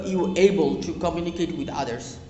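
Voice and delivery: ordinary talk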